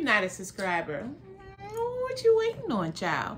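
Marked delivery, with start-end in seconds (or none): 1.6-3.4 high sassy call-out. Real, not acted. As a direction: tease the viewers with sass. Urging viewers to subscribe.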